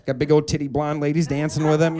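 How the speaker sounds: ordinary talk, nothing marked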